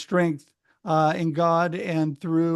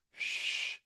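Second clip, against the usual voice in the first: hushing shh; 16 dB quieter than usual. Retold or imitated. Hushing the yelling crowd.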